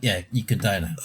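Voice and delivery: usual speaking voice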